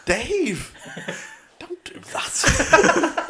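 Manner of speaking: high, very slow laughing Jim voice; imitating Jim. Friend quoting Jim telling Dave off, high and slow. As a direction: drag it out, laughing through it. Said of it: "And Jim goes".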